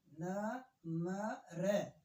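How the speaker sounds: नम्र drawn out very slowly, weight on the final syllable; very slow stressed ending. Shown as a demonstration. Teacher pressing the last syllable for pupils. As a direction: stretch नम्र and press the end. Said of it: "बाद वाले पे दबाओ".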